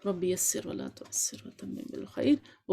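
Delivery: soft, slow whispered dua under the breath; murmured prayer. Real, not acted. Teacher murmuring a dua before class, whispered.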